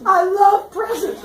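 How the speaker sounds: high, loud, drawn-out delight; hammed-up childish glee; gleeful. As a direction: squeal slowly like an overexcited kid. Son delighted at gift.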